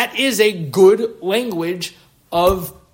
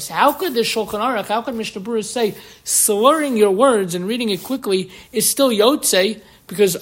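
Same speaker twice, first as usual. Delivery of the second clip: ordinary talk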